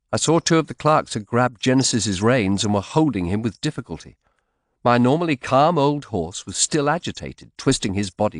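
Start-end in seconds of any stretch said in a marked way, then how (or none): none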